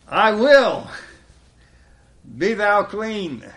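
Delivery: Jesus answering the leper firmly; commanding Jesus. Acted. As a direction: command it with authority. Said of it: "Jesus to respond".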